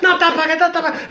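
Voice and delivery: panicked friend. Retold or imitated. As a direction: squeak it like the delirious patient. Friend voiced high, frantic.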